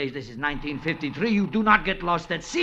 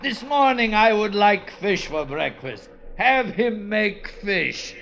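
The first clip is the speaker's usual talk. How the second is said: lordly gloating; slower than usual. Acted. [name] lording over the crew at breakfast, slow and smug. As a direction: lord it over the others like a rich man.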